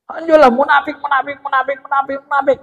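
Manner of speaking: high, anguished cries of munafik; self-reproach. Retold or imitated. Hanjolah scolds himself at home, high anguished self-reproach. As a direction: berate yourself, beating your chest. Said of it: "Dipukulnya badannya".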